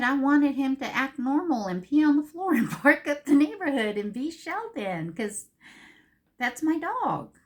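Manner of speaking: loud, high rant with a laugh; laughing exasperation. Real, not acted. Owner wishes her sick dog would misbehave, loud laughing rant. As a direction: burst out half-laughing, half-fed-up.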